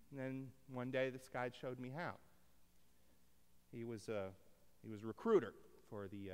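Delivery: usual speaking voice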